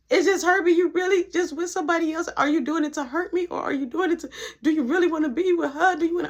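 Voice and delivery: high, insistent string of hurt questions; imitated tearful pleading. Crying ex begs her boyfriend for answers, tearful imitation. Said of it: "as she was crying".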